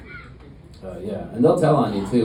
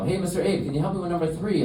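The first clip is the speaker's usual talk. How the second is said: higher-pitched pupil asking for help; middle schooler; 7 semitones higher than usual. Acted. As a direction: put on a light, high schoolkid voice.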